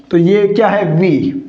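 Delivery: ordinary delivery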